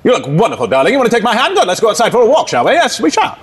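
high, theatrical British accent; swooping, suave British charmer; Michael Blakemore. Shown as a demonstration. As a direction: court your darling in an affected British voice. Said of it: "It's like that guy".

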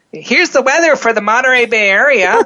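put-on young DJ reading the weather; high school voice. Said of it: "in my little, uh, you know, high school voice and go".

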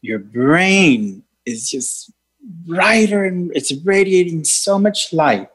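meditation guide voice; guided meditation. Acted. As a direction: lead a healing meditation. Leads a healing meditation.

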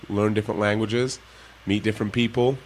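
ordinary talk

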